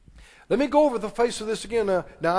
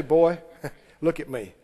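daddy's voice; 4 semitones lower than usual. Retold or imitated. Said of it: "I'm thinking of my daddy".

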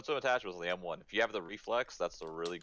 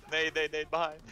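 grenade warning yell; 9 semitones higher, 4 dB louder than usual. Real, not acted. Grenade incoming.

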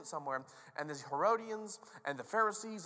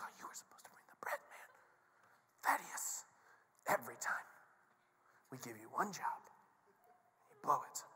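hushed, whispery grumbling; comic under-the-breath scolding; bickering disciples; 3 semitones lower, 5 dB quieter than usual. Acted. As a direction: mutter blame behind the teacher's back. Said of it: "And they're like".

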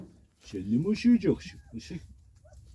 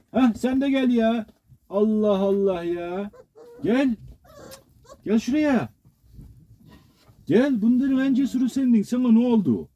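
high calling voice; 6 semitones higher than usual. Real, not acted. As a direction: sing out to the pup, pitched up. Calling a pup over.

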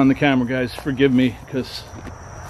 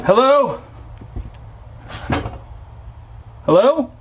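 nervous hail to whoever might be there; calling out; 12 semitones higher, slower than usual. Real, not acted. Calling out into empty house.

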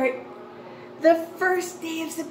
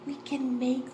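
near-whisper, much softer; hushed wonder; 4 semitones lower, 9 dB quieter than usual. Pretend. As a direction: whisper it like a secret to children. Hushed wonder for young pupils.

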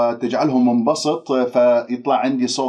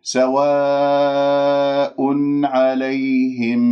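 very slow, long-held tajweed chant; Quran recitation; much slower than usual. Shown as a demonstration. Teacher models the verse for students, drawn-out recitation. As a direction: recite with long level elongations.

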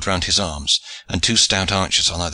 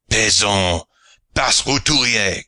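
contemptuous French cry; slower than usual. A narrator's reading. French pirate scorning Sir Nigel as a peasant, French accent. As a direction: spit the French insults down at him. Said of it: "cried the other".

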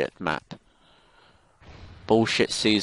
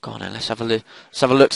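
usual speaking voice

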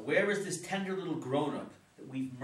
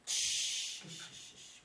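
repeated shushing; urgent shush, fading softer; hushing shh; 5 dB quieter, much slower than usual. Acted. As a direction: hush someone about to shout. Hushing before waking daughter.

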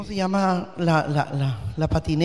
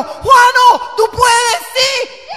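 much higher, louder cheering yell; cheering shout; 7 dB louder, much slower than usual. Retold or imitated. Mother recalls yelling for her son at the skate contest, cheering shout. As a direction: scream him on from the stands. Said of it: "yo estaba gritando".

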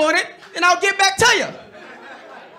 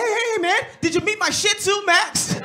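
high friendly dodge; 7 semitones higher than usual. Retold or imitated. Driver sweet-talking the cops.

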